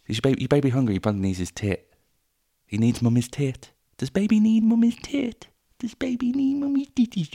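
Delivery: put-on high, cooing baby talk to an infant. Mother cooing to her hungry baby, high baby talk. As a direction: coo it like a doting mother.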